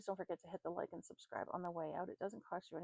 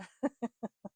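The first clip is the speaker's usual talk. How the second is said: loud burst of laughter; 6 semitones higher, 10 dB louder than usual. Real, not acted. Tired giggle.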